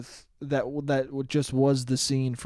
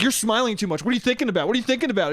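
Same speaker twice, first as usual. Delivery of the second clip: higher, interrogating mock voice; mock suspicious sister; 6 semitones higher, much faster than usual. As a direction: grill him like a nosy sister. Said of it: "She was like".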